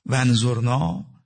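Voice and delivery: ونظرنا stretched very slowly, rising at the end; ونظرنا said slow and pleading with a lifting end; drawn-out plea. Shown as a demonstration. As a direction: draw out ونظرنا slowly like a request. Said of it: "این یه جور حرف زدنه".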